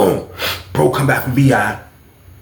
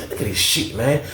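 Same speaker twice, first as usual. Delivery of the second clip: unvoiced, much softer whisper; hushed; 7 dB quieter than usual. Telling his roommate to look, in a hushed whisper. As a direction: whisper it so the guards won't hear.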